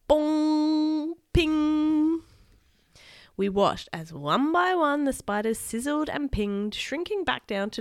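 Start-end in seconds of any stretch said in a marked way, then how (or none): 0.1-2.2 high, loud held chimes; cartoon bong and ping effects; ringing impact noises. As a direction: ring each one out like a bell. Voicing the zapper hits.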